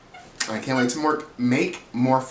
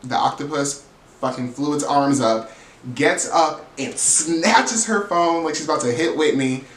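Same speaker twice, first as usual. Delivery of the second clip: ordinary delivery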